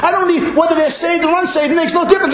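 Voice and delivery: high, fervent mock response; eager congregant. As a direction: gush it as an overzealous church member. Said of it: "You're supposed to say".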